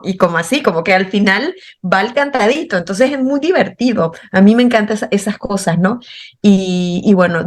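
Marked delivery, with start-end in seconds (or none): none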